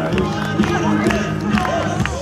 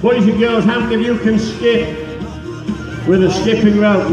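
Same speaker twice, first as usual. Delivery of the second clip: put-on higher, upbeat voice for kids; kids show host; 7 semitones higher than usual. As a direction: pitch it up to ask the children. Host quizzing children in audience.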